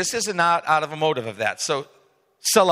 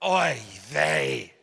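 Yiddish oy vey. Retold or imitated. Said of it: "Peter's like".

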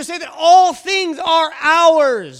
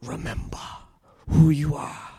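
deep, low Mufasa voice; deep booming lion king father; Mufasa impression; 11 semitones lower, 4 dB quieter than usual. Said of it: "like Mufasa said to Simba".

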